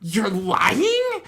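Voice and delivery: loud, high disbelieving cry, laughing; incredulous shout. Retold or imitated. Wonder Woman catching Steve lying, loud and incredulous. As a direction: burst out in shocked disbelief. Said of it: "then goes".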